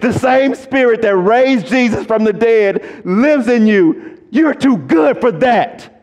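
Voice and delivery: high, loud call that rises to a shout; shouted preaching. Acted. Preacher rousing defeated believers, shouting high and loud. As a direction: preach it out full force.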